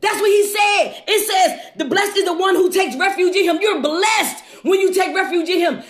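ordinary delivery

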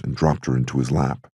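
ordinary delivery

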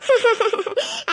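high laughing wail, half crying; mock wailing laugh. Wife wails at husband's insult, hammed-up laughing cry.

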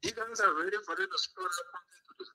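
caller's voice cutting in and out on a bad line; muffled, fading phone line; phone breaking up. Real, not acted. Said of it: "your voice going in and out".

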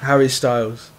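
low, lightly put-on British accent; fake British accent. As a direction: pose as a London lad, low. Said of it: "you just made an English accent".

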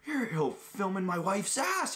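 high, pinched imitation of an accusing gym guy; mock angry accusation. Angry gym guy accusing him of filming, high mock outrage. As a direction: squeak it out like an outraged stranger.

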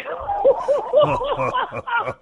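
a long high burst of laughter. Real, not acted. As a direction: crack up hard. Laughing at punchline.

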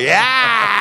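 high drawn-out "yaaah"; hammed-up scare shout; mock monster yell. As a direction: jump out and yell to scare someone. Imitates friend leaping out.

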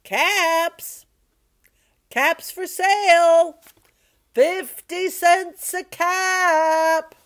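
loud, high peddler's cry; long, high-pitched sales call; street seller calling. Acted. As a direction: call your wares across town, stretching the last word. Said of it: "he walked back to town calling".

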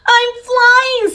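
delighted at finally flying; excited. A narrator's reading. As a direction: penguin lifted by his balloon, thrilled. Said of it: "said Flippy Flop excitedly".

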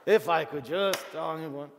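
loud exasperated grumble, eyes rolling; mock grumbling. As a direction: huff and complain like a fed-up spouse. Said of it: "That's grumbling".

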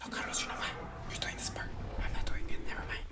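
unvoiced sneaky whisper. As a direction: hide from the raptors, whispering.

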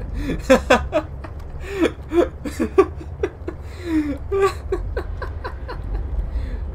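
shrieking, high-pitched laugh fit; helpless high laughter. Real, not acted. Player caught off guard by a surprise, helpless laughter. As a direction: crack up until it squeaks. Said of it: "That made me laugh".

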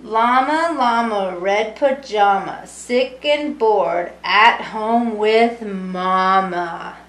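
lively sing-song storybook reading; reading to children. A narrator's reading. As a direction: read it warmly to a room of kids. Reading picture book to children.

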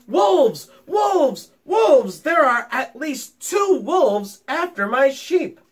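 high, loud alarm cry; yelling. A narrator's reading. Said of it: "this time yelling".

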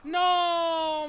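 sudden panicked yell; shout. Real, not acted. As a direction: yell it out in alarm.

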